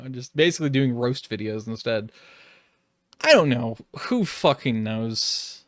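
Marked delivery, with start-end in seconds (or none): none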